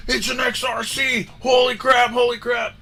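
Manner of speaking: high, loud shout of disbelief; excited yell. Retold or imitated. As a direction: yell it like you can't believe it. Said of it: "and Johnny goes".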